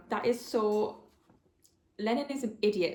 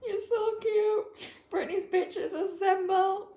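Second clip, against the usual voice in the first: high, drawn-out squeal, almost weepy; emotional gushing; 11 semitones higher, slower than usual. Real, not acted. Reader melting over the boys' support group, gushing squeal. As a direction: coo it way up high, overcome.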